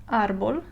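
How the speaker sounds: "árbol" stressed on its first syllable, ÁR-bol. Shown as a demonstration. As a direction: lean on the ÁR of "árbol". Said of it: "if I want to read this word properly".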